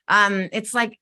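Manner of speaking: ordinary talk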